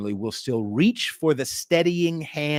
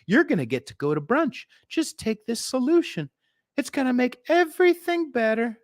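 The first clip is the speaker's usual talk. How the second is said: high, sweet salesman voice; hammed-up state offering a cure; mock government pitch; 6 semitones higher than usual. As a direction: sell it like a smarmy official. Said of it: "They offered".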